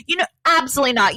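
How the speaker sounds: ordinary talk, nothing marked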